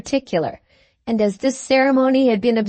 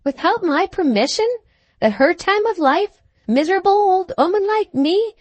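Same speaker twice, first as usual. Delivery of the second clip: put-on high, indignant old woman's protest; rising outraged questions; old lady scandalised by her daughter's kissing; angry outburst; 9 semitones higher than usual. A narrator's reading. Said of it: "Fragments of angry sentences".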